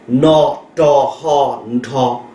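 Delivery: "No to hon to" drawn out, each syllable separate and slow; teacher spelling out "No to hon to" very slowly; slow spelled-out syllables. Shown as a demonstration.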